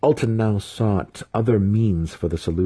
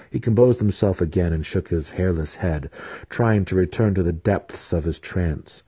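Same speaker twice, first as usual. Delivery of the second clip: ordinary delivery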